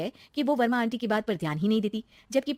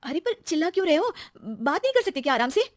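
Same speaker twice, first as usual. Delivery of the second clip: higher-pitched, aggrieved objection; indignant protest; 8 semitones higher, 3 dB louder than usual. Acted. Wife objecting to husband's shouting, indignant. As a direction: push back at being shouted at.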